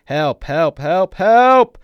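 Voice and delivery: high, very loud repeated screams; full-voiced cries for rescue; screaming for help. A narrator's reading. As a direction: scream it out in rising panic. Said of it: "he starts screaming".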